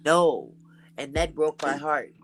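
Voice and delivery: ordinary talk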